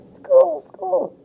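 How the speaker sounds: high little fish yell; tiny fish voices. Acted. Fish in the tank yelling, high tiny voices. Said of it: "yelling in their tiny fish voices".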